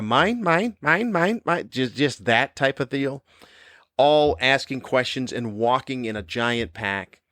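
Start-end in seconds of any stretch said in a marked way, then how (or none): 0.0-1.7 higher squawky bird chant of "mine"; Finding Nemo birds. Retold or imitated. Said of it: "the storks in Finding Nemo with the".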